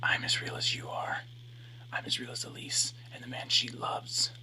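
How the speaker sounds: unvoiced ghostly whisper; eerie whisper. A narrator's reading. Reflection whispers to him to win her back. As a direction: breathe it like a voice inside his head.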